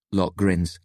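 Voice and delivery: usual speaking voice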